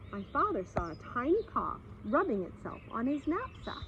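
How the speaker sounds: plain reading voice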